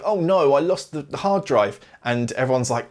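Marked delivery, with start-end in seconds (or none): 0.0-1.8 higher-pitched imitation of a dismayed confession; mock dismay. Astronaut confessing a loss to the crew, mock dismay. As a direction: put on a light "oh no" dismay. Retells character's dismay on returning.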